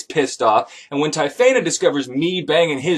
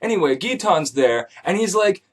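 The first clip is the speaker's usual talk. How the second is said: ordinary delivery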